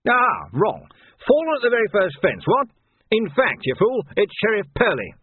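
high, loud, crowing bluster; blustering captain. Acted. Captain catches Vimes out, blustering in triumph. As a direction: pounce on the mistake, pitch leaping up.

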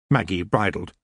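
ordinary delivery, nothing marked

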